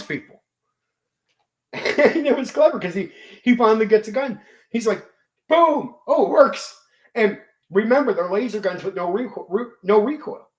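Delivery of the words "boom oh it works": excited. Retold or imitated. Player excited, gun fires.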